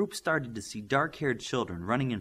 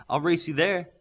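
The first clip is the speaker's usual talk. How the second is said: light, teasing challenge, a bit louder; tossing out a playful dare; mild playful tease to his friends; 6 semitones higher, 6 dB louder than usual. Acted. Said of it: "Darkspeed said playfully".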